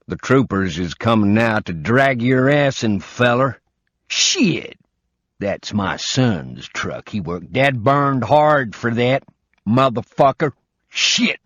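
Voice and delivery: put-on outraged old man swearing at a truck thief; angry old southern man. Old man furious the intruder touched his son's truck, loud southern cursing.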